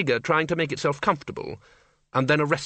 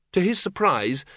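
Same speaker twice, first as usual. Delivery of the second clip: plain reading voice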